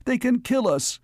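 very high, frightened cry; choked. A narrator's reading. Mayor fearing the strangers' weapon, choked and panicky. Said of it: "choked Bertram Garrus".

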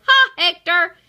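loud, high gloating call; triumphant taunt. A narrator's reading. Chuckles taunts Hector from the cage, loud gloating. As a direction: crow it loudly at the loser.

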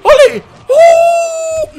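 high loud yell; excited shout. Real, not acted. Gamer yelling as his big combo gets blocked. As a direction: yell out as the hit lands.

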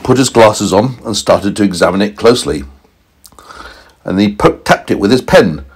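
ordinary delivery, nothing marked

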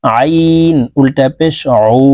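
উ drawn long from the throat; slow drill recitation. Shown as a demonstration. Teacher drilling ain with viewers. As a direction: stretch the vowel slowly. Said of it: "এভাবে করতে হবে".

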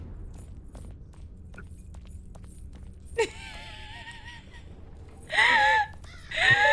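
high-pitched crying laugh; shrieking laugh. Real, not acted.

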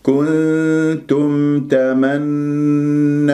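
very slow "كنتم تمنون" with long hummed nasals; full ghunna. Shown as a demonstration. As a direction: sustain the doubled n of "تمنون" as a full hum. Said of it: "نون مشددة الغنة كمل ما تكون".

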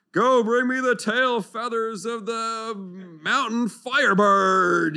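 high, loud and slow, a mock-epic decree; grand prophetic command. Imagined prophet ordering Naaman on a quest, slow and grand. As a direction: proclaim a heroic quest with pomp.

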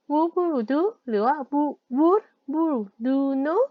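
Hvor bor du nå said slowly, one word at a time; slow model phrase. Shown as a demonstration. Teacher slowly models asking where someone lives now. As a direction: drill the question slowly for the class. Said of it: "บางทีเขาจะถามว่า".

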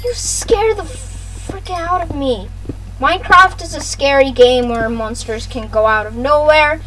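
ordinary talk

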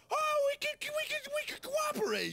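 very high squeaky pleading; mock eagerness. Settlers proposing cooperation to Native Americans, mock eager squeal. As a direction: stammer it out in a put-on high voice.

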